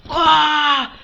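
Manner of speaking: loud, high battle yell; explosive, sustained "Ah!"; Japanese character power-up scream. Retold or imitated. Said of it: "like one of those Japanese characters".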